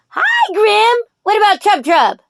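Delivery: high, sing-song coaxing to a pet rooster; doting pet talk. Real, not acted. Greeting her roosters.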